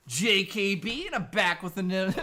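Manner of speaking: mock show intro; slow intro. Shown as a demonstration. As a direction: stretch out the opening like a host. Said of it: "stole Metal Jesus's intro".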